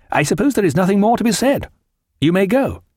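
high, hurried, officious dismissal; huffy young captain. A narrator's reading. Dixon dismissing Aubrey, huffy and high-pitched.

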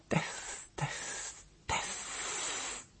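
voiceless whisper, dying away; fading whisper. A narrator's reading. Woman spent after screaming, fading whisper. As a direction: let the repeated word sink to breath. Her outburst dies into whispers.

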